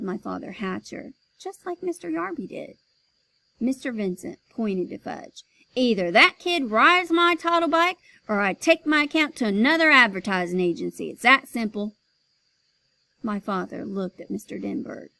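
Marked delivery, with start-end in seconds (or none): 5.7-12.0 ultimatum. Acted. Boss ultimatum.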